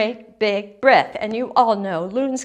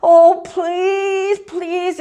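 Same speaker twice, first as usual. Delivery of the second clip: higher voice, building to a loud plea; pleading young grebe; 5 semitones higher, 4 dB louder than usual. A narrator's reading. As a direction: repeat it urgently as a struggling little bird. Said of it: "And he kept thinking".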